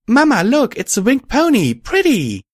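put-on very high, loud child voice; thrilled small-child exclamation; excited little colt. A narrator's reading. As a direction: squeal to Mama in delighted wonder. Excited colt calls to his mother.

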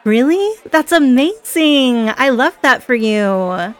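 high, loud start, then drawn-out delight; thrilled for a new friend; delighted enthusiasm. Acted. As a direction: gush with slow, stretched words. Thrilled by the other's answer.

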